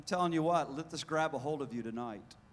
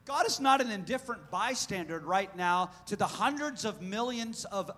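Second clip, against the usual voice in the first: ordinary delivery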